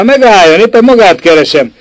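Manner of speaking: urgent hail to a man above; calling out. A narrator's reading. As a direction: keep calling him upward. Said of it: "Kiáltott utána".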